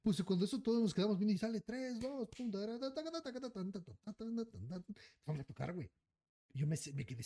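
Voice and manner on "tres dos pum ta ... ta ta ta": high rapid ta-ta-ta bursts; machine-gun imitation. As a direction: rattle off the gunfire. (imitando ráfaga ta ta ta)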